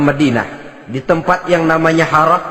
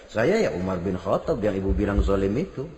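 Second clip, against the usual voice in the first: 1 quiet reveal; 11 semitones lower, 11 dB quieter than usual. Retold or imitated. Umar reveals himself.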